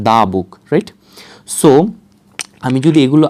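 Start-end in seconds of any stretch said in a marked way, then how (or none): 0.1-0.5 দা book, দা as an open "daa". Shown as a demonstration. Teacher answering own question on pronunciation, দা as "daa". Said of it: "কি উচ্চারণ করবেন?".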